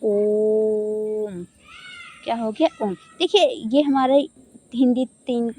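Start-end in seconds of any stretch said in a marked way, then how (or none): 0.0-1.4 ओम with the o held long and slow; prolonged om. Shown as a demonstration. Teacher showing the long om vowel, drawn out. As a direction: chant ओम, drawing out the vowel. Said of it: "अब हो गया ये ओम".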